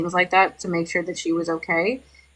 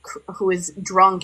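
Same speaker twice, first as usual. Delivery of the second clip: normal talking voice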